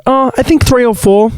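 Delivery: high, sweet innocent reply. Retold or imitated. Speaker answering the bouncer about drinks, in a high innocent voice. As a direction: play it wide-eyed and innocent. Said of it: "And I go".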